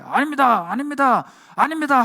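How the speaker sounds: rising frantic denial; panicked denial. Acted. Peter denies Jesus three times.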